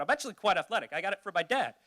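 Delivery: ordinary talk, nothing marked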